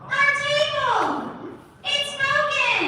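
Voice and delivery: put-on very high, slow child voice; tiny kid voice. Shown as a demonstration. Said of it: "this little tiny kid voice".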